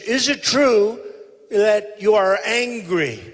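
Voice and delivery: high, drawn-out mock question; mimicking debate questioner. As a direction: imitate a questioner, slowly stretching it out. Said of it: "they said".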